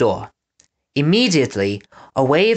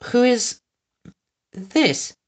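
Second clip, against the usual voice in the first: much higher, clenched-teeth society voice; teeth-clamped society lady; 10 semitones higher, much slower than usual. A narrator's reading. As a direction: call out like a grand lady through locked teeth. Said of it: "drawing back slightly".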